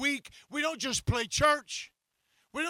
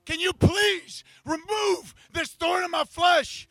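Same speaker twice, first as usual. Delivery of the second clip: voicing Paul's raised, pleading cry to God; 5 semitones higher, 7 dB louder, slower than usual. Paul begging God to remove his thorn, raised cry. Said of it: "He's like".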